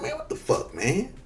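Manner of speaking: mock whispered disbelief, barely voiced; hushed aside. Vlogger quoting his own reaction to the musical scene, whispered. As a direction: breathe it like a shocked aside. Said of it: "I'm like".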